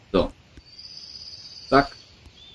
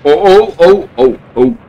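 startled yelps; 9 semitones higher, 8 dB louder than usual. Real, not acted. Explosion goes off near him.

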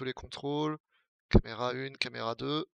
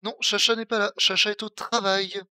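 firm loud reply; 6 semitones higher, 7 dB louder than usual. Real, not acted. Répond fermement au chat.

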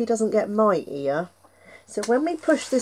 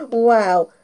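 ordinary delivery

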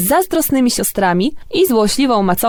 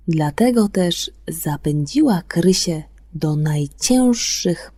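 story narration; 3 semitones lower than usual. A narrator's reading. Radio host to the children listening, bedtime story narration. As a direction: tell it like a bedtime fairy tale. Fairy tale read on air.

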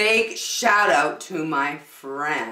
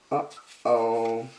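low mock uh-oh; 7 semitones lower than usual. Reacting to the disaster.

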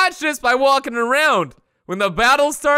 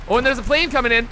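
ordinary delivery

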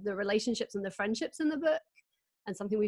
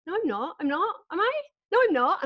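high, indignant mock denial, louder at the end; defensive denial; 9 semitones higher, 5 dB louder than usual. Retelling her denial to the friends, high and indignant. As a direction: protest too much, then doubt. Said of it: "And I was just like".

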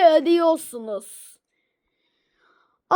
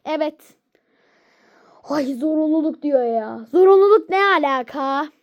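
normal talking voice

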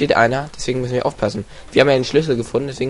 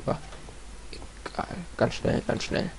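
quick sneaky whisper; hushed sneaking whisper; 6 semitones higher, 14 dB quieter than usual. Acted. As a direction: whisper it fast so nobody hears. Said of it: "ich muss jetzt automatisch leiser resen".

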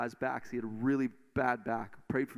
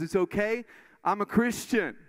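retold call, higher and louder; 6 semitones higher, 4 dB louder than usual. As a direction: call it out boldly across the room. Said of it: "he calls out and he says".

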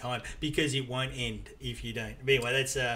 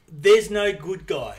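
slow, louder, spelling it out; slow emphatic; 3 semitones higher, 7 dB louder, much slower than usual. Real, not acted. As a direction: lean on every word.

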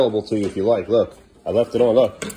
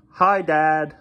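mock child voice; 6 semitones higher, 4 dB louder than usual. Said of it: "How about".

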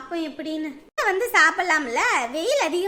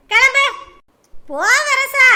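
loud high shout, rising; shouting at goats; 5 semitones higher, 8 dB louder than usual. Acted. Goatherd shouting her goats home, loud high yell.

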